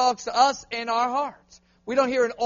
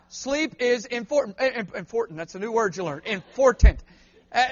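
ordinary talk

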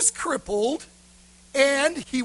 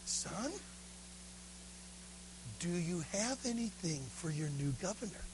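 soft, lower governor's voice; 5 semitones lower, 18 dB quieter than usual. Acted. Governor asking the little beggar boy for a gift, soft. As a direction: ask the child gently and quietly. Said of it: "and he said".

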